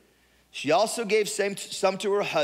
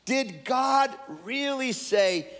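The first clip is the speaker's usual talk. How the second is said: slow, much higher, taunting question; sly serpent sowing doubt, pitched up; mocking serpent; 6 semitones higher, much slower than usual. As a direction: needle her with it, drawn out. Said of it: "so he said to the woman".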